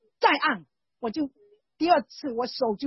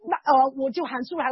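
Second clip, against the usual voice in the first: ordinary talk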